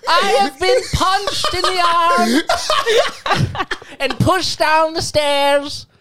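high, slow, laughing proclamation; town crier voice. Retold or imitated. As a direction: announce it like a medieval herald. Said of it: "the sibling's like".

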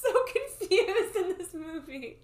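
high, loud laughing through the words. Real, not acted. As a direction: laugh it out, amused. Laughing at actor's face.

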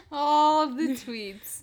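very slow, loud and stretched; slow drawn-out. Pretend. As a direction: drag it out for drama. Dramatic echo of the line.

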